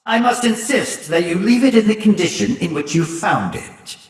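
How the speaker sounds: normal talking voice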